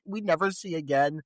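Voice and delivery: usual speaking voice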